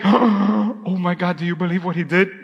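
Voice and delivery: hammed-up gossipy disbelief; mock scandalised. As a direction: pretend God is scandalised. Mocking a scandalised God.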